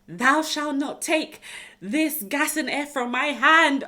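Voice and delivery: slow, loud, high mock-biblical proclamation; mock solemn decree. Clinging to the gas and air, mock-solemn commandment. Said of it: "I remember saying to them".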